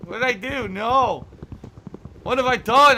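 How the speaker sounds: loud, high, real dismay; panicking at the screen after wrecking the build; dismayed shout. Real, not acted. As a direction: cry out at the ruined build. Ruined his game build.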